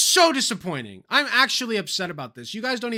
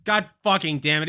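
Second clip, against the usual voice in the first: ordinary delivery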